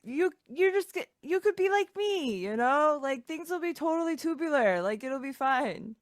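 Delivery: [name], loud and upbeat, consoling; chirpy surfer-type friend cheering them up; [name] in character. Acted.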